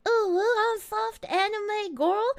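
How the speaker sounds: high, breathy-free mock anime girl; lightly mock a soft anime girl; a hint of a cute anime girl voice. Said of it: "I don't sound like".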